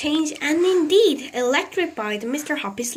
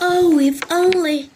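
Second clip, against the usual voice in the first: wistful sigh; 5 semitones higher, 6 dB louder than usual. A narrator's reading. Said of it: "he kept telling himself".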